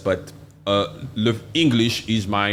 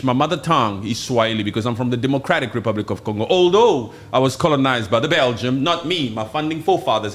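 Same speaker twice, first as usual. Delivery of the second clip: normal talking voice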